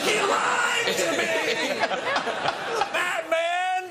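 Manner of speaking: high, laughing mock shout of betrayal; mock therapy outburst. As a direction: yell it like the grown-up son in therapy, half laughing. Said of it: "Going, like".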